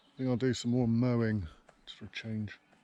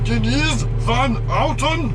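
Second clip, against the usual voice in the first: the name said in a mock German accent, pitched much higher; 11 semitones higher, 8 dB louder than usual. Shown as a demonstration. Bored cab driver to camera, a mock German accent. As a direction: Denise Van Outen with a hammed-up German accent, rising high. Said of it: "saying Denise Van Outen in a German accent".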